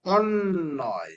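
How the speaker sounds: অন্নয় stretched with a long doubled ন; very slow model word. Shown as a demonstration. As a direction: hold the নন in অন্নয় for the class. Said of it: "কি হবে এটা". (অন্নয় খুব ধীরে, ন্ন টেনে বলা)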